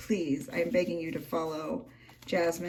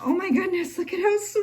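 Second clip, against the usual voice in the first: high, louder squeal of delight; delighted gushing; 11 semitones higher, 5 dB louder, faster than usual. Real, not acted. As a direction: gush over it, pitched way up. Delight at gift's sweet details.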